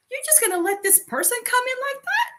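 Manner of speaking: high, quick disbelieving question; mock incredulous challenge. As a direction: challenge a friend in acted disbelief. Acts friend challenging the host.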